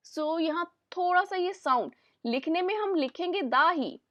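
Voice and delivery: ordinary talk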